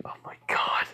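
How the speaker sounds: voiceless whispered exclamation; whispered reaction. Retold or imitated. Onlooker reacts to the insults, whispering. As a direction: gasp it out under the breath.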